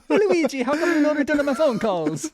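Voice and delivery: high, loud mock-Italian whine; Mario impression. Mario complaining to Luigi, high cartoon Italian voice.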